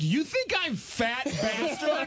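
high, drawn-out incredulous retort; mock outrage. Comic confronts the fan who mistook him, mock outrage. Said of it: "And I was like".

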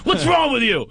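high, loud scolding; hammed-up exasperated psychic; mock outrage. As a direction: bark it at him, appalled. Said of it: "He goes".

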